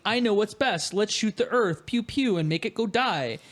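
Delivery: ordinary talk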